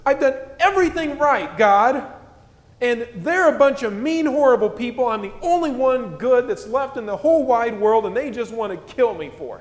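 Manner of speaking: put-on high, loud whining like a toddler; whiny toddler voice. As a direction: whine it out like a screaming toddler. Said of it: "kind of in a whiny toddler voice".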